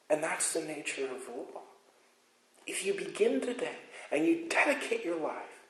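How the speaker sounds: fiery sermon build; preaching at full force. Acted. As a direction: drive it home from the pulpit, rising and loud. Preaching climax on the law.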